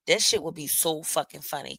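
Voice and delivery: ordinary talk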